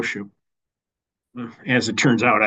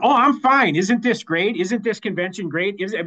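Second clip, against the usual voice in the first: high, chirpy insistence that all is fine; fake cheerful; 6 semitones higher than usual. Acted fake-cheerful brush-off at convention.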